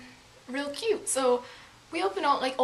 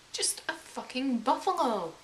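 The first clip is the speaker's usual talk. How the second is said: ordinary delivery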